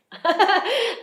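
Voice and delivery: brief high laugh at own failure; a quick self-mocking laugh; short laugh. Real, not acted. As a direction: laugh it off lightly. Rueful laugh after telling failure.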